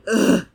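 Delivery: a sudden loud groan of disgust; loud disgusted ugh. Retold or imitated. Inner reaction to bad dubbing, a loud disgusted ugh. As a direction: belt out a fed-up "ugh". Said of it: "in my brain".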